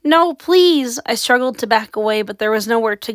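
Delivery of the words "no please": higher, frightened plea; girl begging for mercy. A narrator's reading. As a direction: plead with the ghost. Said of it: "I begged".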